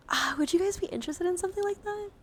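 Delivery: high, loud, eager question; excited pitch. Real, not acted. Streamer pitching a play event to viewers, excited. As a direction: burst out with a hopeful question.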